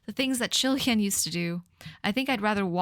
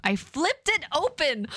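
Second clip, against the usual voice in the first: excited; 7 semitones higher than usual. Acted. Rereading line with excitement.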